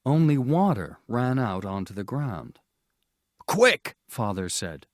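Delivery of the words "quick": a sharp, loud, high call to hurry; urgent call. A narrator's reading. Father urging his family to hand over the other bag, loud and urgent. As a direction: snap it out in a rush. Father finds the ice melted.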